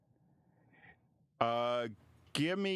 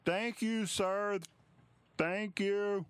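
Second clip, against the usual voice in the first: much higher, calling out thanks; grateful yell; 9 semitones higher, slower than usual. Acted. Stable boy thanking the traveller through the door, high call. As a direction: shout your thanks through a closed door, pitched up. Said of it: "you hear the boy yell from behind the door".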